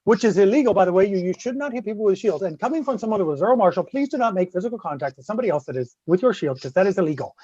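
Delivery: quiet, matter-of-fact safety notice; deadpan disclaimer. Real, not acted. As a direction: drop out of the story into an official warning. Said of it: "deadpan disclaimer".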